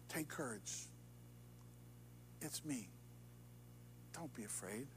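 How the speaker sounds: very soft, gentle comfort; almost breathe the words to calm them; hushed reassurance. Pretend. Preacher voices God comforting the congregation, hushed.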